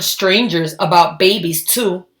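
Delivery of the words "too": ordinary delivery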